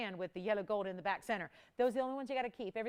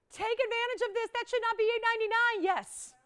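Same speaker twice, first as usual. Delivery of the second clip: much higher, louder bargain hype; hyped sales pitch; 12 semitones higher, 5 dB louder than usual. Real, not acted. Host urging viewers on a bargain, excited and high. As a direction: sell it like a steal.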